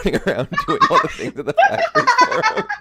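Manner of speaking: words squeezed out through high laughter; can barely finish for laughing; real laughter mid-sentence. Real, not acted. Laughing while describing the joke.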